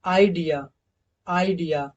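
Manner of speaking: normal talking voice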